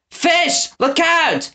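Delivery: very high warning cry; warning scream. A narrator's reading. Winnie warning Fish of Gristle, scream. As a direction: scream to warn him. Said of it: "Winnie screamed".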